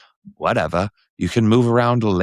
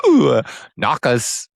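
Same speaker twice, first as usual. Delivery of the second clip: slow, higher cheeky British voice; British accent; 6 semitones higher, 3 dB louder, slower than usual. Pretend. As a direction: savor it like a saucy Brit. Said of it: "say everything British we can think".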